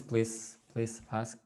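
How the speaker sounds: ordinary delivery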